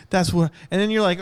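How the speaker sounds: ordinary delivery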